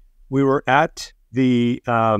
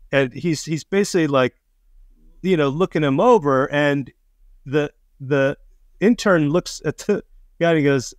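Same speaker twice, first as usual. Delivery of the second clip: ordinary talk, nothing marked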